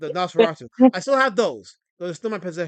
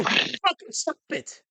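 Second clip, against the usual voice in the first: slow, whispered fucking stop it; whispered scold; 4 semitones higher, 4 dB quieter, much slower than usual. Acted. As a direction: hiss it slowly at the chat in a whisper. Scolding chat again.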